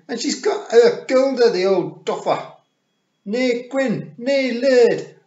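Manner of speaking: high, loud, rough Scots battle yells; rowdy little wild men. A narrator's reading. The tiny clan men charge at the Queen, yelling high and loud in Scots. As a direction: bellow like tiny brawlers charging in.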